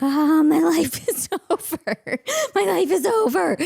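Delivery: high, loud mock despair with laughter; melodramatic wailing. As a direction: wail it like a hammed-up tragedy. Acting her meltdown at wrinkly laundry.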